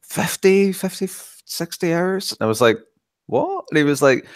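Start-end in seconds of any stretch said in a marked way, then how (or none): 3.3-3.6 a high, squeaky "what?"; mock disbelief. Retelling his reaction to a rushed playthrough, mock disbelief. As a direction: play it as stunned disbelief. Retold reaction to quick finish.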